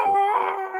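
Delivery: long, very high mock scream; imitated lifter's scream. Weightlifter mid-rep, high mock scream. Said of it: "He's screaming".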